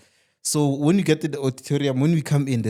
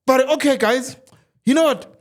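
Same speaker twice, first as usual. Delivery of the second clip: hyped salesman pitch; 3 semitones higher, slower than usual. Retold or imitated. Salesman slashes price dramatically.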